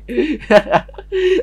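high cackle breaking out mid-sentence; sudden high-pitched giggling fit; bursting laugh. Real, not acted. Player mistaken for a doctor, high helpless laugh.

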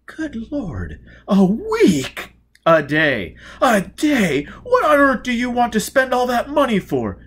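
high, loud disbelief; astonished old gentleman. Acted. Victor hearing the daily cost, loud and high. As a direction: react as if the sum is outrageous.

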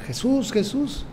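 lightly acted call to Jesus; mild mock cry. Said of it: "Bartimeo comienza a gritar".

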